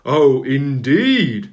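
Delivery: slow, loud and stern; the Fat Controller rebuking sulky engines, loud and severe. A narrator's reading. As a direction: draw out the warning, loud and slow. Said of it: "said the Fat Controller severely".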